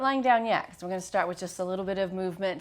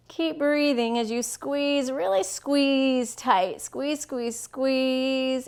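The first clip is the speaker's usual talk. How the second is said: higher, drawn-out squeezing effort; urging effort; 5 semitones higher, 4 dB louder, much slower than usual. Real, not acted. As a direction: stretch the words as you urge them to hold tight. Coaching a tight shoulder squeeze.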